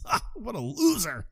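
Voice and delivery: high, soft jeer; imitated high-pitched ridicule; mocking. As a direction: sneer at him in a high put-on voice. Mocking the dead boyfriend.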